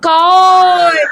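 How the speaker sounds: long-held "koy" as a scold; mock loud scolding. Shown as a demonstration. Teacher scolding the letter ka for pupils, mock scold. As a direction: stretch the syllable into a mock telling-off. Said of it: "का को जोर से डांट".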